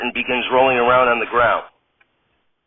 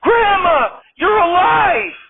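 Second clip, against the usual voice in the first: high, loud, slow yell of joy; shrieking wife, much higher; tripping yell; 4 dB louder, much slower than usual. Acted. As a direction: scream it like she sees her dead grandma. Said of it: "Radnor falls to the ground and yells".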